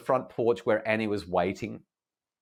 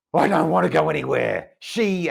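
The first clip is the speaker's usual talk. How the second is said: raised, loud retort from a furious daughter; defiant angry teen; 7 semitones higher, 6 dB louder than usual. Retold or imitated. Daughter angrily refusing to leave the room, loud and raised. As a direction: snap back hard, refusing to budge.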